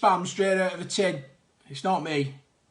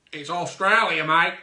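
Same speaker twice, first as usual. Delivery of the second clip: mock Australian accent; 6 dB louder than usual. Heath Ledger born in Australia.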